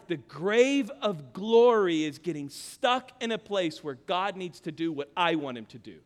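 ordinary talk, nothing marked

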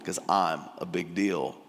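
low, slightly hushed self-importance; self-important flattery. Acted. Elder Pharisee calling a young man teacher, mildly self-important flattery. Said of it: "he's already flattering him".

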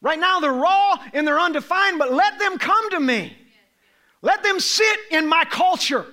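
high, loud, fervent preaching; preaching at full force. Acted. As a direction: drive it home to the congregation. Preaching the children passage.

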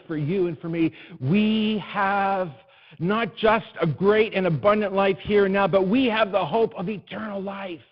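full-force preaching. Acted. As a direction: preach it out to the whole room. Preaching crescendo on Easter.

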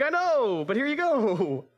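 very high, drawn-out mock cheer; loud, high, sing-out offering; clueless gift giver. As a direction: hand it over in a silly high voice. Imitates a bad gift giver.

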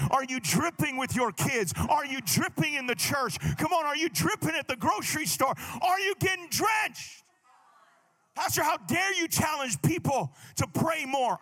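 loud, emphatic pulpit exhortation; forceful preacher, rising to a shout; full-force preaching. Acted. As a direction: press the congregation with punched, building questions.

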